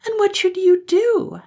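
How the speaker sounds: put-on high, loud anxious child. Blue Eyes asking mother, high and anxious. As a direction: ask fearfully like a small girl.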